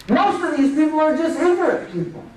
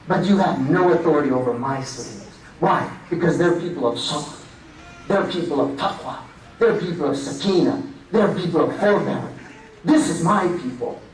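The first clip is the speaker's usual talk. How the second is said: solemn divine voice; 9 semitones lower than usual. Retold or imitated. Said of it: "وَإِنَّ عِبَادِي لَيْسَ لَكَ عَلَيْهِمْ سُلْطَانٌ".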